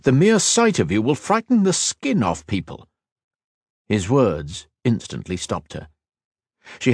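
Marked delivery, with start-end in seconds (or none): 0.0-2.9 high-pitched, urgent warning; insistent. A narrator's reading. Healer warning Kat not to leave, insistent. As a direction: warn her off insistently. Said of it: "he insisted".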